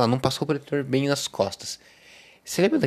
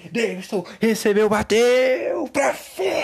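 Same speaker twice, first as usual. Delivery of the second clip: football commentator; 7 semitones higher, slower than usual. Acted. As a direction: scream the near miss like a live sportscaster. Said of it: "colocar uma emoção nisso". (narrador de futebol gritando o lance)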